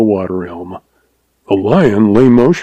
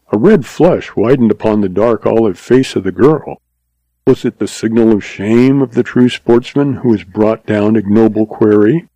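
usual speaking voice

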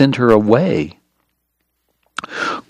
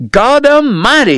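much higher, loud shocked cry of relief; shaken cry; 14 semitones higher, 4 dB louder than usual. Acted. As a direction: cry out after the near miss. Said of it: "he cried".

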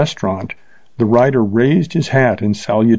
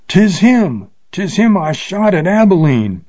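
terrified shriek; 8 semitones higher than usual. A narrator's reading. Bill recognising the man he shot, shrieking high in terror. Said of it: "he shrieked out".

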